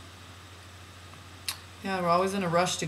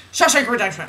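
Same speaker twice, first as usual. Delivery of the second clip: rapid, manic movie-title blurt; Jim Carrey; 5 semitones higher, 6 dB louder than usual. Retold or imitated. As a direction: rattle the title off in a comic voice. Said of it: "I have to copy Jim Carrey".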